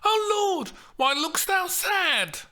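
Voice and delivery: high, loud, alarmed; panicked Juliet. Acted. Juliet panicking at the nurse's sad face, high and loud. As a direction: leap to the worst fear. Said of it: "read out in panic".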